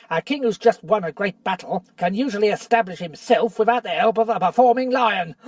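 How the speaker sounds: lightly voiced bitter dwarf; sneering dwarf. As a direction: needle Caspian with rising scorn. Nickabrick mocks the lion.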